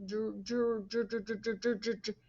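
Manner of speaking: soft quick dr- dr- dr- over and over; quiet repeated dr- drill; repeated dr sound. Shown as a demonstration. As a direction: pulse the dr- sound softly. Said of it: "say the word drum".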